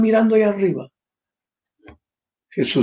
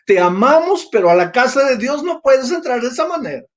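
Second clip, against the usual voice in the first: higher, mocking sing-song of a smug doorman; sarcastic church gatekeeper, pitched up; mock pious rejection; 8 semitones higher, 3 dB louder than usual. Shown as a demonstration. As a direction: throw his hypocrisy back at them, higher. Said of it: "Es interesante, ¿no?".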